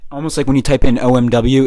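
ordinary talk

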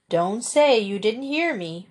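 usual speaking voice

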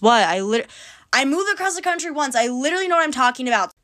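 high-pitched mock-confident brag; sarcastic boasting. Podcaster boasting of her one-move credentials, sarcastic. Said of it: "that was sarcasm".

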